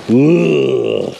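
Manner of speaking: a raspy cartoon clown's disgusted "ugh"; Krusty the Clown groan. For viewers at the junkyard, a Krusty-style disgusted groan. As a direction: groan like Krusty recoiling from something gross. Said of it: "That's my best Krusty the Clown impression".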